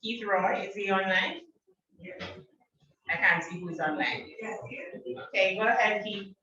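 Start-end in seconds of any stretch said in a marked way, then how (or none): none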